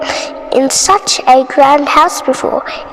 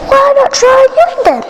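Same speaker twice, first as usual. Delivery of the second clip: farmer; 10 semitones higher than usual. Acted. Farmer voiced.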